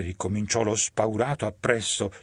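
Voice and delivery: plain reading voice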